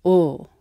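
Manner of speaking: a hollow, back ol sound; dark L. Shown as a demonstration. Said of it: "it's a dark L".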